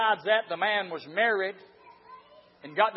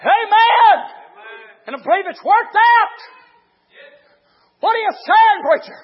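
raised, emphatic pulpit exhortation; full-force preaching; 7 semitones higher, 11 dB louder than usual. Real, not acted. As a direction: drive each phrase home to the congregation.

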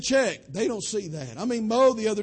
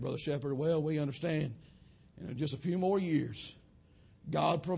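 low, soft, hushed preaching; quiet closing sermon; 7 semitones lower, 7 dB quieter than usual. Acted. Preacher softly assuring the church of God's care. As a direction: bring it down gently after the climax.

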